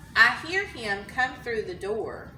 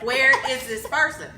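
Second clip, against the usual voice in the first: aggravated; 3 semitones higher, 7 dB louder than usual. Retold or imitated. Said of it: "he's aggravated".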